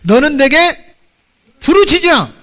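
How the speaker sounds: loud, high-pitched proclamation; ringing preacher's shout; crying out scripture. Acted. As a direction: call it out like a command from scripture. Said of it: "성경에 뭐라고 얘기했느냐?".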